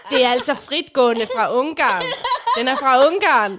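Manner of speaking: put-on stalking old lady, loud and laughing; insistent loud. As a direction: press the point loudly while laughing. Lady chasing her through shop.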